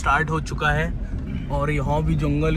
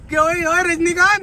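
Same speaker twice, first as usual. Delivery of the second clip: calling out; 11 semitones higher, 4 dB louder than usual. Real, not acted. Calling out to the friend.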